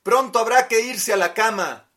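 mother calling out; loud call. Acted. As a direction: raise the voice to call from afar. Mother calls from kitchen.

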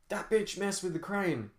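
high-pitched yell, no extra volume; yelling rifleman. A narrator's reading. Rifleman warning the others about the sabotaged crane, restrained high yell. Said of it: "yelled one of the riflemen".

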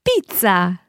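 "Pizza" as a native Italian says it; authentic Italian pronunciation. Shown as a demonstration. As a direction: model "Pizza" in Italian for learners. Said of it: "authentic Italian pronunciation".

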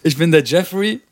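high, drawn-out announcer voice; Bruce Buffer. Acted. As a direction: boom out the name like a ring announcer. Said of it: "Bruce Buffer".

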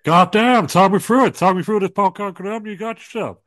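loud, high Stone Cold rant; Stone Cold impression. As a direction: yell it high like the Texas Rattlesnake.

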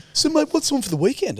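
high-pitched casual mate chat; chirpy morning joggers. Retold or imitated. Joggers making small talk early in the morning, chirpy high-pitched imitation. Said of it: "everyone's talking like it's early in the morning".

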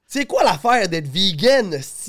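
lightly hammed-up parody of a ranter; imitating Lewis. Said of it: "tu l'imites bien". (imitation moqueuse d'un chialeux)

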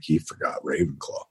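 lightly hushed gossip; mock hushed. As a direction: murmur it like grim news. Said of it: "And like".

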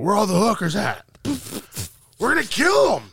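high-pitched mock yell; retold drunken yelling. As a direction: holler it high like a rowdy drunk. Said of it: "yelling this stupid bullshit".

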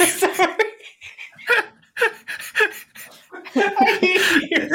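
high voice squeezed by real laughter; helpless laughter. Real, not acted. Podcaster to the co-host who wrote it, helpless laughter. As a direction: gasp it out between laughs. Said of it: "He keeps disappearing out of frame".

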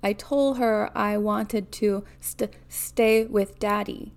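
plain reading voice

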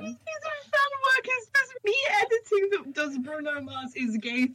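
high, squeaky talk through laughter; laughing while talking. Real, not acted. Teasing friend about fan fiction, laughing helplessly. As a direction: crack up while getting the words out. Cracking up over own fan work.

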